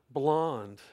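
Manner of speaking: ordinary talk, nothing marked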